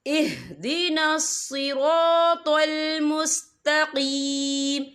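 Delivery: slow, drawn-out tajweed chant; Quran recitation. Shown as a demonstration. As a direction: recite it slowly and melodiously. (धीमी तिलावत)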